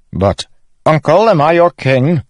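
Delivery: high, loud insistent question; demanding. A narrator's reading. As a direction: demand an answer from your uncle. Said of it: "Elhokar demanded".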